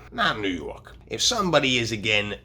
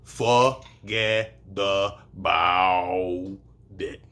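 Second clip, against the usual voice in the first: Brooklyn accent; much slower than usual. Shown as a demonstration. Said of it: "the way you spell".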